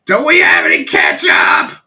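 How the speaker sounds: loud, high-pitched shout through the house; angry yelling upstairs. Retold or imitated. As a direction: re-enact bellowing upstairs in frustration. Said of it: "I'm, like, yelling upstairs".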